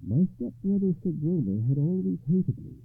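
put-on young noblewoman recounting her wrong; earnest lady. A narrator's reading. Voices the transformed lady's tale.

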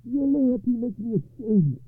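high, loud, slow confession; pained, drawn-out admission from a king; ashamed king. A narrator's reading. King admits shame to nephew.

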